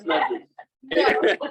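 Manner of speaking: slow, laughing mock disgust; drawn-out laughing disgust. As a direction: pull a face at someone else's diaper. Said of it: "you're like".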